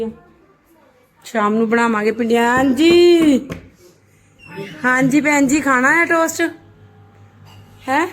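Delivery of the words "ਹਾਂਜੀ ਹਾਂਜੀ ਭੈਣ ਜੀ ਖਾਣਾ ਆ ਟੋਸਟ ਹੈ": loud, high dramatic aunty greeting. Pretend. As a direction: call out like a nosy aunty. Mother playfully greets daughter.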